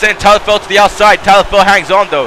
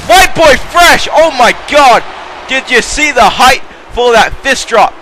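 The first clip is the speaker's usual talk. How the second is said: excited shout; 4 semitones higher than usual. Real, not acted. Commentator erupting as Fresh drops from the top, shouting. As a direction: lose it at the big fist drop. Huge fist drop from the top.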